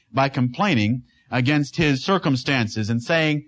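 normal talking voice

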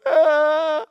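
sudden high loud yelp; mock toddler yelp from biting a stone; startled cry. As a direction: yelp as a biting child would.

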